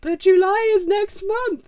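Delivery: high, slow mock sob; mock whine. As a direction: whimper it like a sulking child. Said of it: "And then I'm like".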